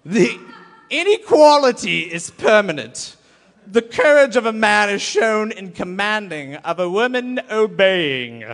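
hammed-up booming ancient sage; Aristotle voice. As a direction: bellow it like a pompous old philosopher cracking up. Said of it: "in a very Aristotle voice".

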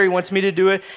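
normal talking voice

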